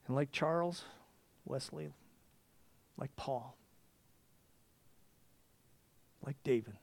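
soft, hushed prayer voice; quiet confession. Real, not acted. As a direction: confess it softly, almost to yourself.

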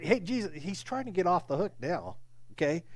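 ordinary talk, nothing marked

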